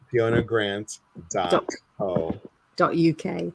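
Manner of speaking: slow American reading of the web address; American-accented, drawn-out spelling-out. Real, not acted. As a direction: read it out slowly in an American accent. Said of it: "look at that American accent coming through".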